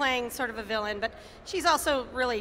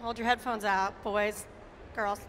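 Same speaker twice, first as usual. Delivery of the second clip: usual speaking voice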